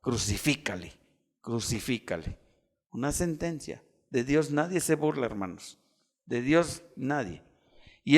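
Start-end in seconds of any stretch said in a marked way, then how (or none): 0.0-2.4 low, soft echo of a crowd's cry; subdued retold shout. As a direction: recall the mob's shout quietly and gravely. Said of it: "que un día gritaron". (grito recordado en voz baja y grave)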